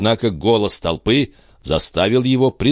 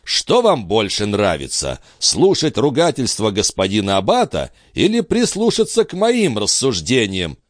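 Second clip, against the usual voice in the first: plain reading voice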